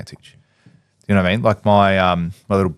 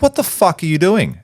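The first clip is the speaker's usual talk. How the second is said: sharp, raised, higher mock outburst; angry retold yell; 9 semitones higher than usual. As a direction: fire it at him like an angry boss. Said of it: "that's exactly how I said it".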